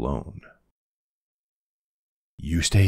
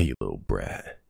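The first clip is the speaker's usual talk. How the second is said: a light, low, softer tease; playful teasing; 8 semitones lower, 7 dB quieter than usual. Real, not acted. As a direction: tease her fondly under your breath. Teasing after she laughs at him.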